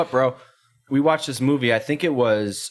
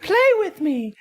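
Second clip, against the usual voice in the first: high, eerie toy doll voice, much higher; creepy toy voice; slower than usual. Retold or imitated. Telling his buddies how the toy spoke, high creepy doll voice. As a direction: imitate a haunted Elmo calling from the dark.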